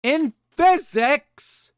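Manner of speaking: drawn out, high and stressed; slow emphasis. Shown as a demonstration. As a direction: lean hard on "In physics". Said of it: "Listen closely".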